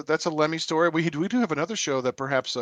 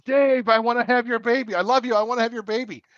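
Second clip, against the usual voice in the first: high, loud imitation of a fan's holler; raised, gushing mock yell; retold yell; 7 semitones higher, 4 dB louder than usual. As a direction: re-enact yelling at a drummer on stage. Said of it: "I remember yelling at Dave".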